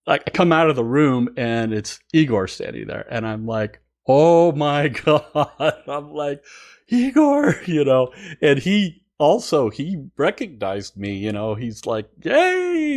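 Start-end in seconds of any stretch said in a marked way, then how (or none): 4.1-5.8 drawn-out, louder and higher exclamation; retold awe, stretched slow with a laugh; starstruck amazement. Coming out to find Igor standing there, amazed. 6.9-7.6 high, loud call of recognition; retold excited cry on spotting someone; delighted greeting. Fan spotting Igor in the hallway, delighted greeting. 12.2-12.9 very high, drawn-out happy cheer; imitated squeal of recognition; delighted greeting. Igor recognizing him before a hug, imitated delighted cheer.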